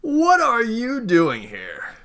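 high, slow, drawn-out welcome; surprised greeting. Acted. Character spots a friend arriving, surprised greeting.

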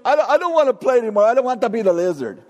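put-on echoing the boy's plea to quit; whining child. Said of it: "do you ever just say to yourself".

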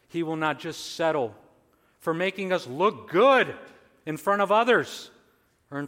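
emphatic sermon, rising to a loud peak; forceful preaching. Real, not acted. Preacher warning against just looking good, forceful.

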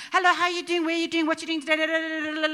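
high, loud, breathless run-on chatter; mock teen text message. Mother mocking her daughter's friends' run-on texts, high and loud. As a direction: rattle it off like one endless teen text. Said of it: "it's like, like one long".

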